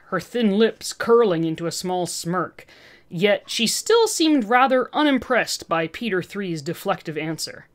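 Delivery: ordinary delivery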